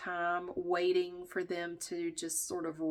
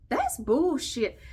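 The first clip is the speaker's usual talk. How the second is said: slow, louder and higher, dragging out bullshit; emphatic outrage; 7 semitones higher, 6 dB louder, much slower than usual. Real, not acted. Venting to viewers about the one-day holiday, slow indignant outburst. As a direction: spit it out with disgust.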